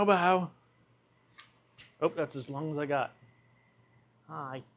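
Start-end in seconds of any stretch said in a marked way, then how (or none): 4.3-4.6 quiet cooing hi to a cat; soft hello to cat. Real, not acted. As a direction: coo it gently. Greeting the cat.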